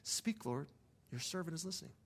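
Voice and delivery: softly spoken, hushed prayer; quiet prayer. Retold or imitated. Driver praying to the Lord with eyes open, quietly. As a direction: pray it softly, pausing midway. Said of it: "I keep quietly praying".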